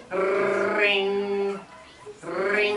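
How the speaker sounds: high trilled ring, held long; distinct phone ring. Retold or imitated. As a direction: imitate an old telephone bell. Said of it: "distinct ring".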